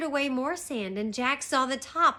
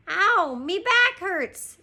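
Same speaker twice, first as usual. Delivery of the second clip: loud, high, drawn-out pirate wail; slow, high-pitched complaint; crying out in pain; 8 semitones higher, 7 dB louder, slower than usual. A narrator's reading. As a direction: moan it out as a hurt deckhand. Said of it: "Stinky cried".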